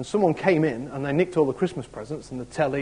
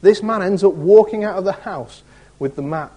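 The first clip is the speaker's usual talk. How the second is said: ordinary delivery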